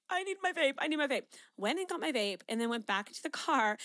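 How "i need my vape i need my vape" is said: higher, louder retold panic; mock panic. Retelling her panic before leaving for the airport, mock frantic. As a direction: replay the anxiety attack, pleading. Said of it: "started freaking the fuck out and was like".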